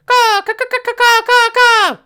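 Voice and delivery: piercing high raven caws; shrill screeching bird woman. Acted. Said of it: "calls back".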